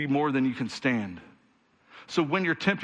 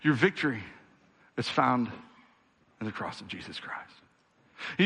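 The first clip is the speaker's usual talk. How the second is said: normal talking voice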